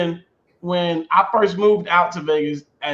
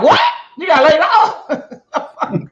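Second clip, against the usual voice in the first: high, slow squeaky mock disbelief; squeaky-voiced friends; 10 semitones higher, 4 dB louder, much slower than usual. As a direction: imitate a friend's squeak, laughing through it. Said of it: "the squeakiness in their voice".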